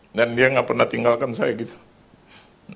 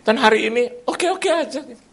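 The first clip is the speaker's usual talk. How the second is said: laughing; 11 semitones higher than usual. Real, not acted. Laughing relief in sermon.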